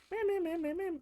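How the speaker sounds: high-pitched whiny meh-meh noises; mock bickering. Girlfriend imitating the couple's back and forth, mock bickering. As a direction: mimic petty squabbling in a high voice. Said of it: "bickering back and forth".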